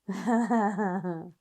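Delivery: spontaneous run of laughter; real laugh. Real, not acted. Tarot reader amused by the cards, laughing. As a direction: burst out laughing at what the card shows. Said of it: "I shouldn't laugh like that".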